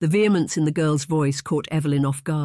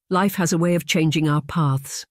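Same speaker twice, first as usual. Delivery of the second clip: plain reading voice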